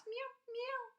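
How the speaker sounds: high mewing; catbird meow. Retold or imitated. Reading the catbird's call as a high meow. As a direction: mew like a hungry cat.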